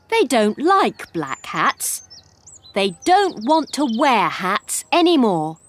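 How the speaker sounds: plain reading voice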